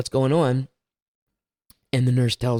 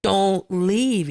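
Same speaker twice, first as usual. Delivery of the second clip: higher-pitched, mildly panicked plea; a touch of pleading panic; retold panic; 8 semitones higher than usual. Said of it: "like, panicking, almost like".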